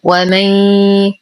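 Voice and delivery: ومن slowly, ن melting into a long nasal y; merged nasal. Shown as a demonstration. Teacher demonstrates merging, long nasal glide ending ومن. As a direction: hum the end of ومن as a held y. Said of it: "إدغام ناقص مع غنة كاملة".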